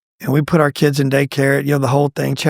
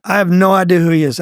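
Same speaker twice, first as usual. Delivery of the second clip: ordinary delivery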